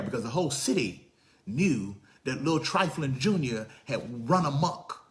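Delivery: ordinary delivery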